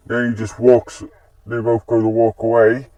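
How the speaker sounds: ordinary delivery, nothing marked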